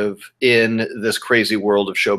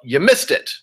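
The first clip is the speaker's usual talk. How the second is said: slightly raised, higher retold yell; mild mock yell; 5 semitones higher than usual. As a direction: imitate a choreographer calling out a mistake, half-strength. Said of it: "he'd point you out and yell".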